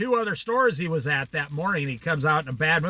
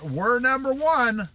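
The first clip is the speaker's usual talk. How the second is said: slow, drawn-out words, high and pointed; slow pointed reminder; 5 semitones higher, slower than usual. Retold or imitated. Narrator slowly reminding the regional manager, pointed. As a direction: spell it out so he can't forget.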